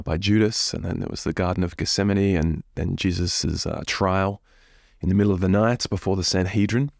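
usual speaking voice

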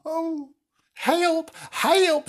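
high, thin damsel cry for help; cried for help. Acted. Princess lost in the dark woods calls out high. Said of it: "she cried".

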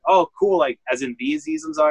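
retold excitement, pitch jumping up quickly; mildly starstruck. Shop owner retells meeting a famous comedian, mildly starstruck.

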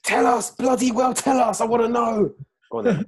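high, loud, mock-impatient demand. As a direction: beg to be told, hammed up.

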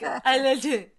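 imitated baby talk, "love you" run into "leh-tu"; toddler's unclear speech. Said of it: "it isn't a clear".

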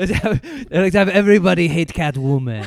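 a hammy Frenchman, barely holding the laugh; mock French accent. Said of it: "the only way I can do the accent".

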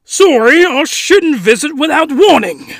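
hammed-up fake British accent; terrible British accent. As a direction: do a bad British guest apologizing. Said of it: "terrible British accent".

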